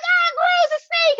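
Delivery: very high, loud mock shriek; disgusted scream. People in future see a snake, shrieking in disgust. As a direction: squeal as if you just spotted something revolting.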